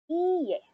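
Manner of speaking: iie with a long, held E. Shown as a demonstration. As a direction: stretch out the E of iie. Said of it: "Longer pronunciation of the E".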